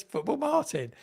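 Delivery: high-pitched imitation Geordie; Adam Armstrong's Geordie accent. Footballer spots the content creator at kit launch, imitated Geordie accent. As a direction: put on a Geordie lilt, pitched right up. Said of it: "in that Geordie accent".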